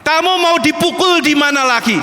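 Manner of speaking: high, drawn-out call rebuking a nation; angry God. Retold or imitated. Preacher voicing God to wayward Israel, angry and high. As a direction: thunder it as God's mock-angry rebuke. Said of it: "Tuhan tuh sudah marah".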